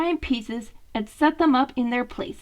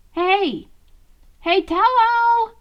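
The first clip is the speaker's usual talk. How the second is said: high, loud, drawn-out call to wake a friend; 9 semitones higher, 5 dB louder, slower than usual. Acted. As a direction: call his name across the table, stretched slow. Leo calls out to Donnie.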